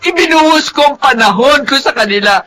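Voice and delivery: tearful shouting plea; distraught crying. Acted. Distraught mother asks her brother why her children fail, crying. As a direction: cry out asking why, near breaking. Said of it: "sikapin mong huminahon".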